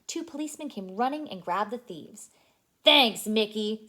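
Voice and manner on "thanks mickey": policeman. Acted. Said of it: "said the policeman".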